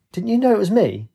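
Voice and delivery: high-pitched, put-on voice of protest; incredulous. A narrator's reading. Katie to Rafe, high incredulous protest. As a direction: question it in high disbelief.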